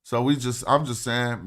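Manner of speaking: ordinary delivery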